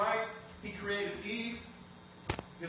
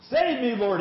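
mild acted shout; 5 semitones higher, 6 dB louder than usual. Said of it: "he shouted".

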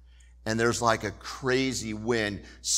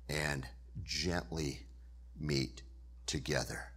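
God's gentle whisper; 7 semitones lower, 8 dB quieter than usual. Acted. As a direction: speak low and tender, like a whisper. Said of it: "that whisper of God".

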